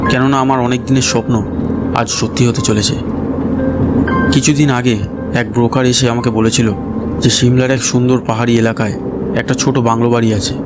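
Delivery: plain reading voice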